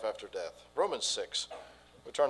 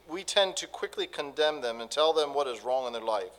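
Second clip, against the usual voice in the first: usual speaking voice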